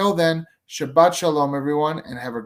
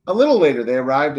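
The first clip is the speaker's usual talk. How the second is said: storytelling; 8 dB louder, faster than usual. A narrator's reading. Narrator reads to children.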